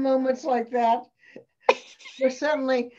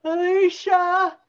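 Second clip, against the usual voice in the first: calling out; 8 semitones higher, 8 dB louder, slower than usual. Real, not acted. Calling out to colleague.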